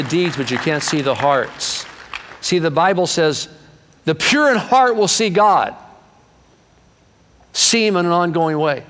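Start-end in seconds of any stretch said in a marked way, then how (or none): 2.4-8.8 much higher and louder, soaring; preaching climax. Real, not acted. Preacher proclaiming to the congregation, higher and louder. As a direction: lift it to the rafters.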